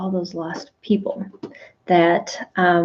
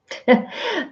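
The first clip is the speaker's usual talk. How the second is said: light laugh; 11 semitones higher than usual. Real, not acted. Laughs at own example.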